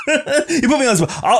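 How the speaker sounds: high, laughing protest. Real, not acted. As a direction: protest through embarrassed laughter. Laughing at own failed accent.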